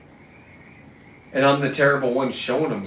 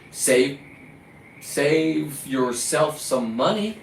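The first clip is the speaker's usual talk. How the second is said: slow, sarcastic stretched-out advice; slow mocking drawl; much slower than usual. Real, not acted. Fisherman mocking store buyers, slow and sarcastic. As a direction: stretch money out slow with a rise.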